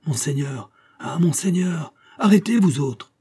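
recognition rising to a high, loud command; exclaiming, building to a shout. A narrator's reading. Guard recognising the minister, then stopping his comrades with a shout. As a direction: start astonished, end shouting at the guards. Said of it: "s'écria".

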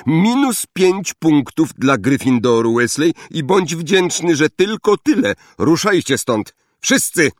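high-pitched, commanding teacher's rebuke; stern reprimand. A narrator's reading. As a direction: hand down punishment and order them off. Snape punishes Ron, orders all away. (surowa, podniesiona nagana)

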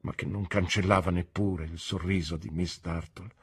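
usual speaking voice

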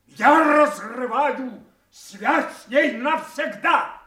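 king renouncing his daughter, loud and slow; furious declaration. Acted. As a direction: tear the bond apart aloud. King breaks ties in rage.

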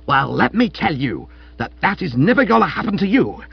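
high, loud commanding tirade; ringing costermonger bellow. A narrator's reading. Wife forbidding husband a knighthood, ringing bellow. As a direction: lay down the law like a street hawker. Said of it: "rang through the room like that of a costermonger".

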